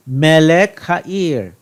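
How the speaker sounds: Melech Ha'ir slowly with stress on Melech; slow modelling. Shown as a demonstration. Teacher showing segolate nouns: slow, Melech stressed. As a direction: draw out Melech for the class. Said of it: "Notice the segolate here".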